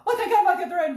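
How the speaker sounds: high loud laughing gasp; laughing relief. Retold or imitated. Mother reaching the slide end, high laughing shriek. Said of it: "I'm like".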